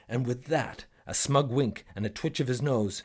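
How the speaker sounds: ordinary delivery, nothing marked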